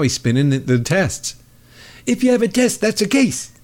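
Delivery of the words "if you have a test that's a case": mock Trump. Mimicking Trump on testing.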